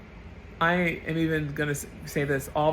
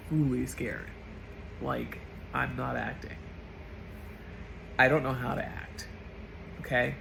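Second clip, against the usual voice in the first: holding back tears; 3 semitones lower, 7 dB quieter than usual. Real, not acted. Vlogger admits real fear to viewers, teary and soft. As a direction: speak through welling tears, voice dropping. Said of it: "I'm tearing up as I say this".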